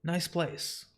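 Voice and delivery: plain reading voice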